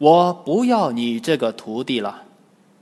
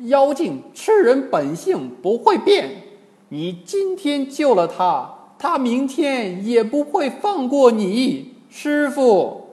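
high, insistent character voice; agitated high-pitched protest; urgent Monkey King; 10 semitones higher, 4 dB louder than usual. A narrator's reading. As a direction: warn the master, pitched up and pressing. Wukong argues urgently with master.

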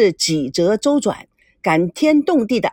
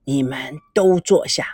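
put-on old grandfather; 8 semitones lower than usual. A narrator's reading. Old grandfather speaks to family.

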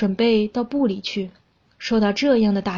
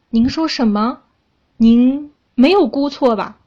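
louder, halting astonished question; stammering disbelief; 3 semitones higher, 6 dB louder than usual. A narrator's reading. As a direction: ask haltingly, taken aback. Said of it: "他结结巴巴地说".

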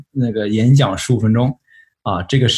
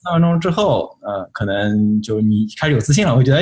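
normal talking voice

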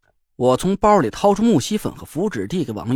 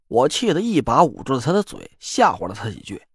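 plain reading voice

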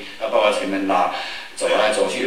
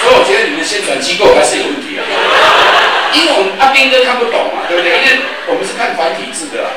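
joking shout; 9 semitones higher, 8 dB louder than usual. Real, not acted. Joking jab at audience.

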